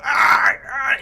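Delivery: high-pitched "ah" cries; struggling cry. Acted. Game master as creature fighting the entangle, high yelps. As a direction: yelp twice, caught and fighting. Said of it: "you hear this voice go".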